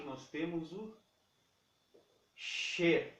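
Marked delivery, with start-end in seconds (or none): 2.3-3.1 "ship" with a short vowel near e; ship demo. Shown as a demonstration. Teacher models ship, short vowel near e. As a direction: keep "ship" short, almost "shep". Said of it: "o I com som de E".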